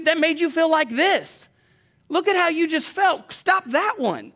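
high, loud, urgent sermon delivery; forceful preaching. Real, not acted. Preacher urging a congregation not to quit God, forceful.

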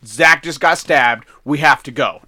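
loud, slow, emphatic news; retold urgent order. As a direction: lay it out firmly and loudly, acting it. Acts out Daniel's urgent announcement.

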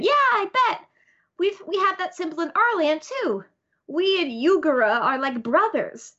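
put-on high, eager little-kid voice; cute excited babies. Voicing cute babies at the symbol.

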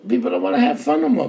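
fast, high and loud frustration; rising frustration bursts out; exasperated outburst. Real, not acted. Ranting about lost fun.

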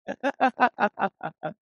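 high burst of laughter, falling. Real, not acted. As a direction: let a high laugh tumble down. Laughing at own punchline.

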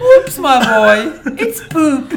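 high slow laughing British voice; hammy posh English gasp; British accent. Pretend. Mock English exclamation, high.

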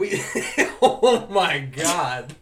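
can barely get it out for laughing. Real, not acted. Cracking up at joke.

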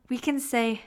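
ordinary delivery

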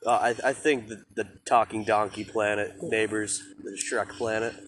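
normal talking voice

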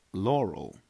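flat, clipped machine Laurel; computer voice imitation. Shown as a demonstration. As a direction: do the recording's voice. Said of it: "I'm doing a perfect replica of it".

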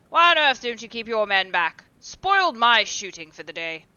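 loud irritated rebuke; angry scolding. A narrator's reading. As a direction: berate the keeper. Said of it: "answered Geoffrey angrily".

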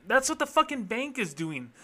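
usual speaking voice

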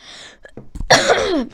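short rough cough; coughing fit. Real, not acted. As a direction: cough into the mic. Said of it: "Musste kurz husten".

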